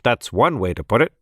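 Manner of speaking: a hint of a dry dad; understated father voice; lightly voiced father. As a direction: answer like a wry father, barely changed. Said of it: "said Chester's father".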